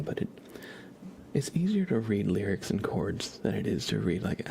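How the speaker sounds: normal talking voice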